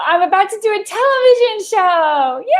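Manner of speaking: high, rising announcement into a squealed yay; so excited. Real, not acted. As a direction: burst with the news, ending in a long cheer. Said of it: "And I'm so excited".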